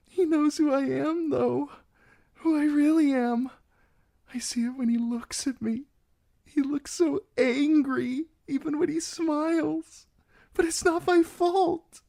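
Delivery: put-on high, girlish and anxious; a frightened young bride trying to sound brave; nervous young bride pleading her case.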